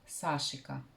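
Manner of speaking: "Сашика" as SA-shi-ka, stress on the first A; chosen pronunciation. Shown as a demonstration. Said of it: "я пока буду произносить".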